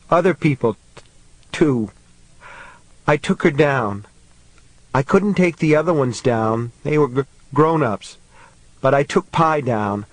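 mild sobbing, catching on words; crying child. A narrator's reading. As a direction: struggle to get words out through tears. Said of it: "David was crying harder now".

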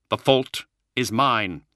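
slow, grave confession; grave admission. A narrator's reading. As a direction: take the blame slowly and solemnly. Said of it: "he said gravely".

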